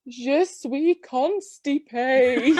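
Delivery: a very slow, mock French "Je suis constipée"; slow, deliberate schoolgirl French; very slow embarrassed French admission; French accent. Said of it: "ten out of ten for the French accent".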